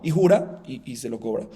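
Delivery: normal talking voice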